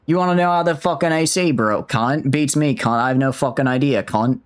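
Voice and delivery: Australian accent played for overkill; overdone Australian accent. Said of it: "I may have gone a little overkill".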